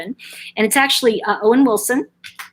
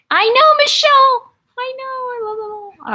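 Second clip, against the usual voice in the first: squealing excitement; 5 dB louder than usual. Real, not acted. Replying to a viewer's chat comment.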